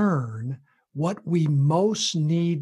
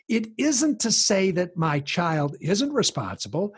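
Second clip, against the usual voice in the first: usual speaking voice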